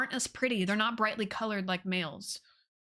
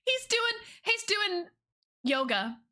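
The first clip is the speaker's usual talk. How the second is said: cutesy coo; 9 semitones higher than usual. Acted. Cooing at squirrel pose.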